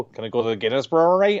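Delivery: a mild mock American accent with a rising question; a visitor asking for the brewery in an American accent. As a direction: put on a light American tourist accent. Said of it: "sorry for my American accent".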